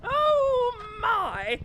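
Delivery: a sharp, high startled exclamation; surprised. Acted. Innkeeper startled to find customers, high surprised cry. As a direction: caught off guard, voice jumping up.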